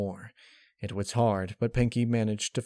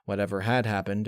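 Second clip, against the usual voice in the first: usual speaking voice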